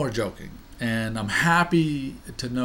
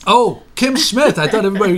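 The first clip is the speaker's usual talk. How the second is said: big high host greeting; excited; 9 semitones higher, 11 dB louder than usual. Acted. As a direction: welcome her with grand surprise. Mock-grand host greeting.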